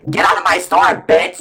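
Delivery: loud angry yell; shouting. Retold or imitated. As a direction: yell her out of the store. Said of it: "I wanted to say".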